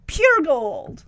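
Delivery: pure gold drawn out, relishing each word; savoured repeat. Shown as a demonstration. Reviewer relishing Freddie's moment, savoured delivery. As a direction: linger on pure gold like a compliment to the chef. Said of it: "was pure gold".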